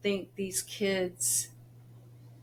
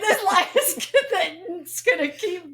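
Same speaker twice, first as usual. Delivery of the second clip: laughing; 11 semitones higher, 5 dB louder than usual. Real, not acted. Mom laughing to the host about the lie continuing. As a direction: crack up as you say it. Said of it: "laughing at the lie".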